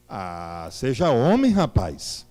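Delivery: normal talking voice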